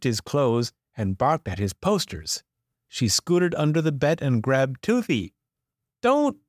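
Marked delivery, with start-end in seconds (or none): 6.0-6.4 sharp warning shout. A narrator's reading. Bobby shouting at the puppy grabbing Toothy, sharp cry.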